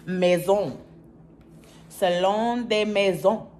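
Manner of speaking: mock French pronunciation of a shop name; mock French accent. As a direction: ham up the French name like a fancy brand. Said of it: "I don't even know if that's a French accent".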